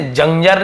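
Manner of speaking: "जंजर" with "uh" in the first syllable; wrong first vowel. Shown as a demonstration. Teacher warning students off a misreading, "जंजर" with "uh". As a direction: misread ginger as "जंजर", jun-jar. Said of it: "ऐसे जंजर नहीं पढ़ेंगे".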